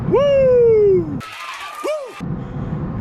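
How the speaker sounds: whooping party yell; rowdy party animal. Acted. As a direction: let out a wild woo. Excited whoop after callsign.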